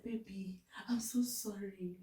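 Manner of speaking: soft unvoiced whisper, pleading; hushed pretend apology to her husband, whispering. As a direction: whisper it sweetly to make him feel bad. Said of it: "I'll go quietly".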